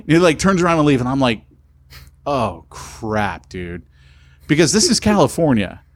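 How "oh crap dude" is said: low, hushed retold dismay; soft, low "uh-oh" delivery; sinking realization. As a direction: drop the voice as trouble dawns. Realizes he may be reported.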